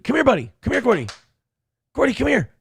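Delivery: high coaxing call to the dog. Real, not acted. Owner coaxing Gordy the dog over, high call.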